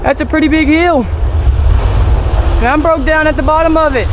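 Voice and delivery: winded after pushing uphill; out of breath. Real, not acted. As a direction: gasp the words out, winded. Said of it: "Let me catch my breath".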